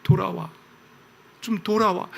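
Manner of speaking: soft pleading after an outburst; God. Acted. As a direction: coax them back quietly. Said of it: "막 그랬다가 또 갑자기". (화내다 말고 나직이 달래는 하나님)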